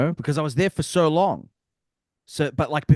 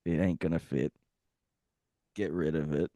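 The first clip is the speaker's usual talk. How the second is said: mock inner voice; 8 semitones lower than usual. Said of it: "I was just like".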